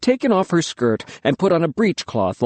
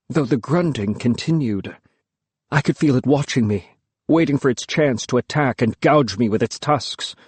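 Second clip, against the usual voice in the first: ordinary delivery, nothing marked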